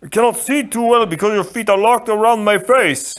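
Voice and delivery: a big polite man, puzzled; giant voice. Acted. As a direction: giant complaining to the Sicilian on his neck. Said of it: "he went on".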